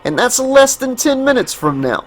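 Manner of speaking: high, grave Bronx worker voice; Bronx worker warning. Acted. Worker warning about the toxic flood, grave Bronx voice. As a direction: warn them of the flood like an old hand.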